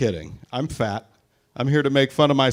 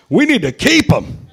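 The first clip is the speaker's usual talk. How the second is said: mock outburst; 8 semitones higher, 7 dB louder than usual. Mock pro-life outburst.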